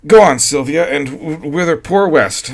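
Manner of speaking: the husband gaily coaxes his wife to go on. A narrator's reading. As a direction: keep it deliberately light.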